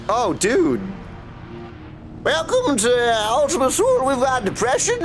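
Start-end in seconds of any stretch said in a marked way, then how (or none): none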